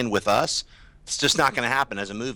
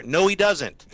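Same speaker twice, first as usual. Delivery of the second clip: ordinary talk, nothing marked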